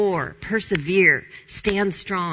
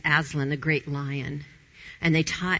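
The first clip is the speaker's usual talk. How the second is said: normal talking voice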